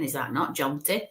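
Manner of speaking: usual speaking voice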